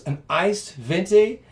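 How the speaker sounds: high, loud, amazed listing of the drink; wife stunned and thrilled; wife amazed he knew her exact order; delighted surprise. Retold or imitated. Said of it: "she goes".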